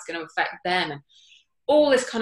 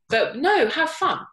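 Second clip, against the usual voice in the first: excited; 8 semitones higher, 3 dB louder than usual. Real, not acted. Excited punchline.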